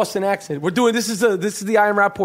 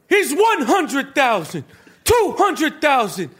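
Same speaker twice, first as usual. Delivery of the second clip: slow, high, heated counting; hammed-up boss, each amount drawn out; Danny Aiello; 7 semitones higher, slower than usual. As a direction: count out money in angry defiance. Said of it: "That's Danny Aiello as the head coach".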